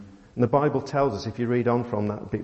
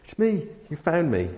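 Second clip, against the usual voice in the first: much higher, bright hammed-up "it's me"; excited mock reveal; 7 semitones higher than usual. What Jesus did not say to Mary, as a hammed-up excited reveal. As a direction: play an over-the-top joyful reveal. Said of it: "you know, sort of".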